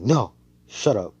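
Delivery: loud, quick mock protest; mild acted annoyance. Acted snapping to silence someone.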